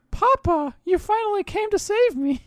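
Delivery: put-on very high, cutesy anime girl; mock little-girl voice. Imitating captive girls greeting their papa, mock little-girl voice. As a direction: squeak it like an adoring child.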